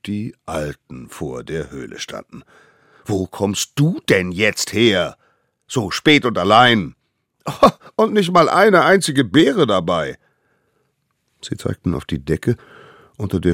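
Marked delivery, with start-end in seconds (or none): 3.0-10.2 loud, rising indignant scolding; scolding elders. A narrator's reading. Elders scold the child outside the cave, indignant. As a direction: tell him off, climbing to a high huff.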